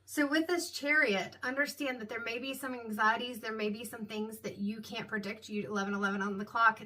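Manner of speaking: ordinary talk, nothing marked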